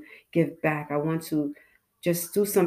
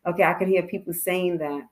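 ordinary delivery

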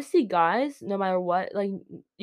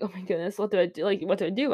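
usual speaking voice

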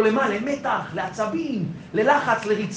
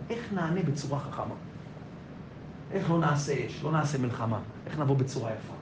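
quiet inner thought; 7 semitones lower, 9 dB quieter than usual. Retold or imitated. Inner thought, voiced softly.

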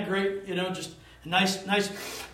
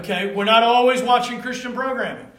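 emphatic; 3 semitones higher, 7 dB louder than usual. Real, not acted. Preacher pressing the point.

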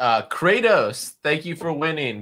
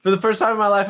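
ordinary talk

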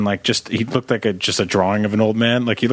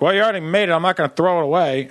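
grudging grandpa; 5 semitones higher, faster than usual. Retold or imitated. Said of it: "he'd be like".

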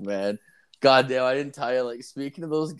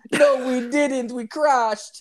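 put-on higher, louder Jamaican-accent retort; Jamaican guy; 13 semitones higher, 5 dB louder, much slower than usual. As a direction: insist in the teammate's Jamaican accent. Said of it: "this Jamaican guy".

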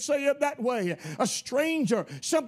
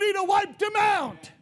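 mock angry shout; 8 semitones higher, 4 dB louder than usual. Moses furious at the idolaters.

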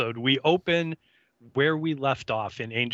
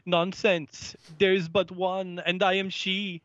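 higher-pitched, deliberately bad Jamaican accent; 8 semitones higher than usual. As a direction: do a hammed-up Jamaican accent as Kendra. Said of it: "she says everything in a horrible Jamaican accent".

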